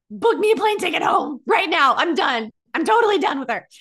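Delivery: high, loud, fed-up outburst; exasperated yell, acted out; retold losing it. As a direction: reenact a phone meltdown at full voice. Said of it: "I lost my shit a little bit".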